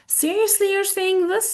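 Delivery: high, amazed questioning. Shown as a demonstration. Teacher reacts to weird news, amazed.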